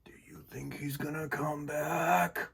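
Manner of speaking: slow, anxious whisper; worried whisper. Acted. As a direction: ask it under your breath. Frightened family whispers.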